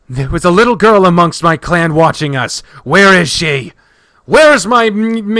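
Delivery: loud, high frantic shouting; hysterical rage. Acted. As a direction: demand answers at a rising, desperate shout. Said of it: "renewed rage fueled by hysteria".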